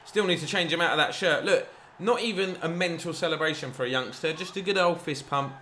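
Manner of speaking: ordinary talk, nothing marked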